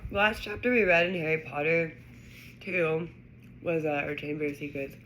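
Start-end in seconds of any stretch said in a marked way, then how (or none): none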